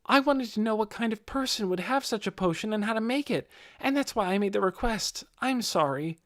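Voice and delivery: put-on a boy owning up, pitched high; earnest young man. Young man confesses his motive.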